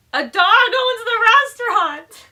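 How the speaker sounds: high, slow, loud outburst; shout. Retold or imitated. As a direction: yell it out in protest. Retorting to Brian.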